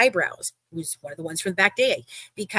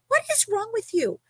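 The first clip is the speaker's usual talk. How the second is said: exasperated confrontation; 12 semitones higher than usual. Retold or imitated. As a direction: retell it as an incredulous scolding.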